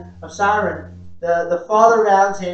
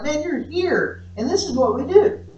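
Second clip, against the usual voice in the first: insistent retort; 8 semitones higher than usual. Retold or imitated. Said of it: "And I'm like".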